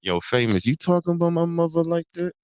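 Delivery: mock aggressive closed-mouth New York voice; Kanan. Mimicking a TV character's aggressive talk, singsong rise. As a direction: mouth barely open, pitch climbing high into the challenge. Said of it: "they always close their mouth when they talking".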